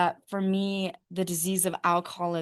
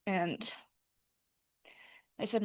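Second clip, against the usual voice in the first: normal talking voice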